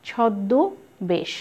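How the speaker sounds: ছদ্মবেশ slowly as chhod-dõ, besh; lightly nasalise the vowel after doubled d in ছদ্মবেশ; slightly nasalised demonstration. Shown as a demonstration. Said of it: "সামান্য একটু নাসিক্য করে উচ্চারণ".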